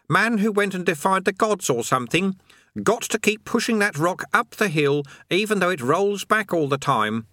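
put-on eager young lad; lightly voiced boy. A narrator's reading. Eric voiced in story.

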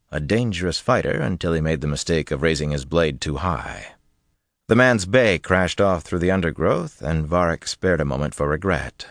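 usual speaking voice

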